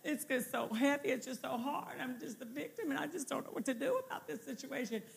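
preacher mocking a believer who plays the victim, soft and whiny. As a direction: mope like someone feeling sorry for themselves. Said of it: "you're not gonna get any victory talking like that".

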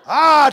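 loud high playful "Ah" mid-jump; scare yell. Retold or imitated. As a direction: yell it as a prank shout. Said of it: "my yell changes".

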